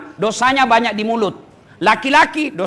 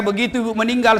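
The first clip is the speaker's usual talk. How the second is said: usual speaking voice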